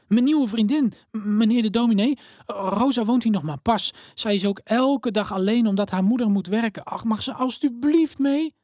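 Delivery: put-on a poor girl asking the minister a favour; pleading little girl. A narrator's reading. Elena begs the minister to take Rosa too, high and pleading.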